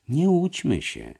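ordinary delivery

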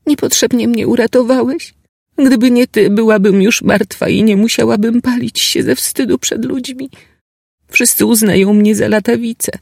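put-on high, anguished girl's voice; a shamed girl in despair; despairing young woman.

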